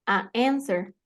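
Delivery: "A answer" with the vowels kept apart; pausa entre vocales. Shown as a demonstration. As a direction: leave a gap between "A" and "answer". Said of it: "Lo mismo". ("A answer" con las vocales separadas)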